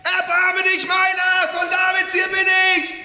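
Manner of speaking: high, slow, loud desperate cries. Acted. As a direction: shout over the whole crowd. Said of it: "schrie nur umso lauter".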